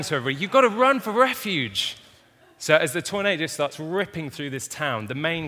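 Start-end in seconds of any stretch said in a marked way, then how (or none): 0.4-2.0 high, loud hammed-up American accent. Scientists yelling to everybody about the tornado, in a hammed-up American accent. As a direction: yell it like a panicked American in a film. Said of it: "This is an American accent, by the way".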